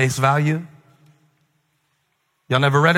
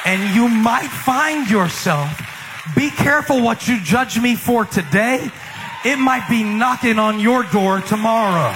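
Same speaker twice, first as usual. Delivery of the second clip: higher, shouted warning; preaching shout; 8 semitones higher than usual. Real, not acted. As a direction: warn the congregation at full force.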